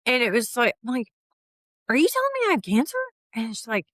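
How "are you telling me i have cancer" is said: high, loud and fast, words tumbling out; retelling the moment of hearing the diagnosis; stunned disbelief. Retold or imitated. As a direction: blurt it out in shock at the news. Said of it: "like, in shock".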